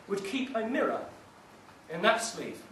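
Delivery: plain reading voice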